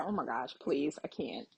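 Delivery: holding back tears; choked up. Real, not acted. Moved by the game reunion, near tears. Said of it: "Am I about to cry?".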